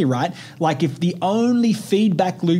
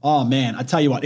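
usual speaking voice

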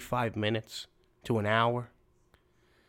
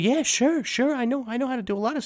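put-on much higher, fast eager kid voice; 14 semitones higher, 5 dB louder, faster than usual. Kid jumps at the chance to help, high eager voice. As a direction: squeak it out like a keen young kid.

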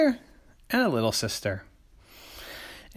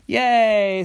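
high, loud cheer; 11 semitones higher, 8 dB louder than usual. Acted. Sisters cheering the rescued cat. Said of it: "said little sister".

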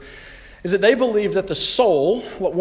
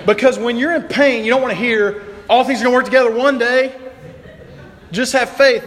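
exasperated; 7 semitones higher, 5 dB louder than usual. Pretend. Preacher mocking empty comfort.

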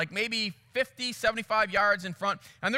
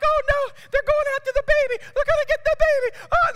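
very high, loud falsetto wail; hammed-up high-pitched panic; imitated panicking tourists; 8 dB louder than usual. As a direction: shriek like horrified onlookers. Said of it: "you can hear the people like".

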